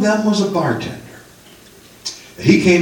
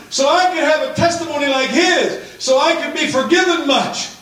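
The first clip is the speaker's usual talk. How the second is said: anguished plea; 12 semitones higher than usual. Retold or imitated. Confused teen begging God why he was saved too soon, high and pleading.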